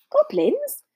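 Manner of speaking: high, startled squeak on goblins; shocked. A narrator's reading. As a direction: goblins yelped in alarm. Harry startled.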